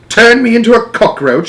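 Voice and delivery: high, loud scoffing shout; father shouting in scorn. Acted. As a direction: scoff it at full volume. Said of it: "shouted Mister Jenkins".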